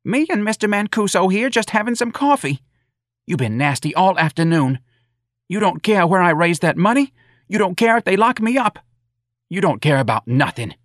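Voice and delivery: high, forceful maternal scolding; angry tirade. A narrator's reading. Mrs. Riley berating her uncaring son, angry. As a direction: pile up the accusations, high and angry. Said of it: "said in an angry voice".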